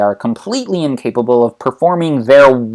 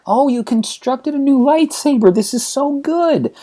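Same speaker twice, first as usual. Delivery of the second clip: gushing delight; 12 semitones higher than usual. Acted. Mother admiring her son's new lightsaber, gushing high. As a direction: coo high over it like a proud mom.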